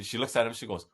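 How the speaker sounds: ordinary delivery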